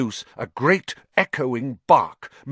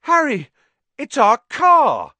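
loud, much higher, overjoyed; relieved shout; 11 dB louder than usual. A narrator's reading. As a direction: cry it out in delighted relief. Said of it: "his voice breaking with relief".

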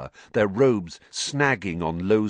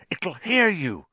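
urgent, higher, frightened; Harry frantically shushes a panicking Ron; frantic hushed warning; 10 semitones higher than usual. A narrator's reading. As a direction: hiss it urgently to silence him. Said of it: "said Harry frantically".

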